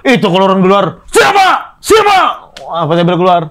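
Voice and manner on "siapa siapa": high, loud excited calling out; excited shout. Retold or imitated. People outside spot him in uniform, loud excited calls. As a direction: shout it like a stunned crowd spotting a cop.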